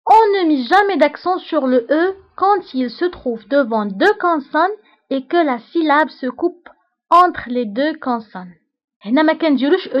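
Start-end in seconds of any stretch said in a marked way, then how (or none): 0.1-8.5 slow, deliberate dictation of a rule; dictating to a class. Shown as a demonstration. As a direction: read it out slowly so students can write. Teacher dictates French rule.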